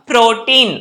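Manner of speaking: normal talking voice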